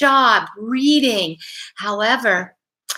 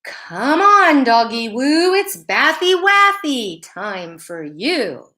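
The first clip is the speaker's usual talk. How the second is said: twittering aunt; 2 semitones higher, slower than usual. Acted. Great Aunt Bleach coaxing Bill into the bath, twittering baby talk. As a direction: coo it like a sugary aunt luring a dog. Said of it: "Bleach twittered".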